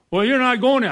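high, loud scoffing jab; mock the digger from the side; scoffing neighbour. Mocking neighbour voiced.

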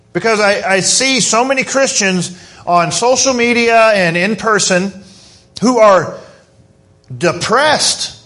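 ordinary talk

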